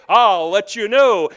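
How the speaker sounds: slow, drawn-out loud reply; the Lord answering. Retold or imitated. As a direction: stretch each word like an unhurried divine answer. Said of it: "The Lord said".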